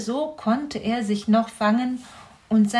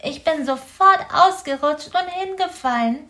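raised, small hedgehog voice; a little hedgehog telling what happened; high-voiced hedgehog explaining his fall; young hedgehog explaining; 7 semitones higher, 5 dB louder than usual. A narrator's reading. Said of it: "erklärte er".

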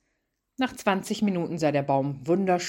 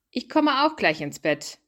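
called out after them, higher and a bit louder; calling after; 7 semitones higher, 3 dB louder than usual. Acted. Ben calling after wife and son leaving the room. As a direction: call it down the hall to the others. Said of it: "Ben rief hinterher".

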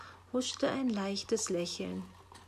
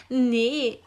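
laughing; 3 semitones higher, 10 dB louder than usual. A narrator's reading. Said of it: "lachte sie".

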